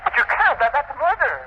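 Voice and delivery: high, panicked protest; horrified. Acted. Assistant refuses the professor's bomb plot, horrified high cry. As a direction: recoil in horror at the plan.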